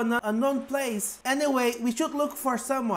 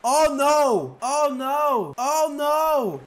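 loud, drawn-out wail of dismay; acted panic; 5 dB louder, much slower than usual. Passenger on a sinking ship, wailing in panic. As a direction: wail each cry in mock despair.

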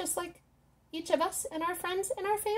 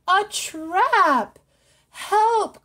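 higher, louder cry for help; trapped fish crying out for help; 6 semitones higher, 9 dB louder, much slower than usual. Acted. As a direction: call out in alarm. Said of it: "cried the fish".

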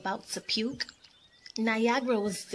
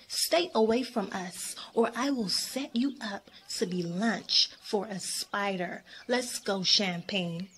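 ordinary delivery, nothing marked

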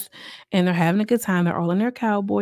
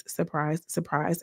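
dry aside; 2 semitones lower, 9 dB quieter, slower than usual. Real, not acted. Commenting on the damage to her face, dry aside.

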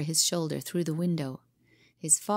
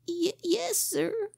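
timid stammer; 14 semitones higher, slower than usual. Acted. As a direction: stammer it out, caught and afraid. Scared boy stammers.

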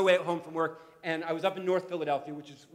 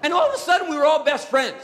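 ordinary talk, nothing marked

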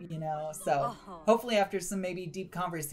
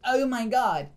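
shocked exclamation; 6 semitones higher, 6 dB louder than usual. Pretend. Wife Sim reacts to flirting.

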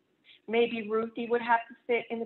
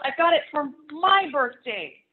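loud, high it's mine; yelled claim; 7 semitones higher, 9 dB louder than usual. Acted. Ruthie yells at Martin over the camera, loud and high. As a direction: yell it to win the argument. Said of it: "she yelled".

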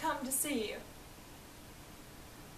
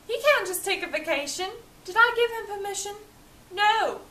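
higher and louder, quick indignant rant; indignant fury; 6 semitones higher, 5 dB louder than usual. Acted. Young master fuming that his butler took a day off, louder. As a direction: build to a snapped final No.

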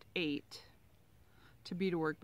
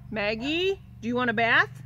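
talking to the dog; 4 semitones higher, 9 dB louder than usual. Real, not acted. Calling to her dog.

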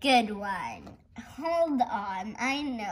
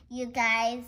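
normal talking voice

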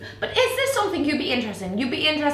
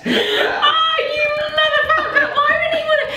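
high, loud outraged laughing yell; laughing shout; 5 dB louder than usual. Real, not acted. Sister mocks the proposal, laughing outraged shout. Friend insults her marriage.

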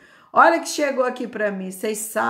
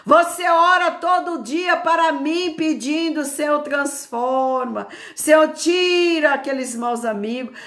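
impassioned preaching, raised and loud; Jesus; 6 semitones higher, 6 dB louder than usual. Acted. God rebuking a weeping mother, impassioned preaching. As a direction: press each reproach with rising force. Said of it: "Jesus aí fala pra mim assim".

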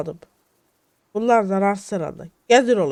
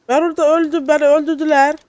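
loud, high protest; outraged complaint; 6 semitones higher, 6 dB louder than usual. Real, not acted. Player protesting to his companion that he died, loud and high. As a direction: complain loudly about being killed. Complaining loudly about dying.

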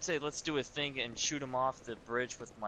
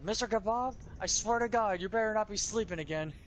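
scolding; 8 semitones higher, 4 dB louder than usual. Pretend. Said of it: "I was like".

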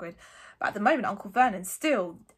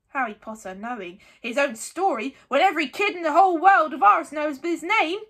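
loud rising protest; indignant; 6 semitones higher, 9 dB louder than usual. A narrator's reading. Hagrid protesting on Harry's behalf, indignant. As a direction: build in volume and pitch. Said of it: "The anger faded".

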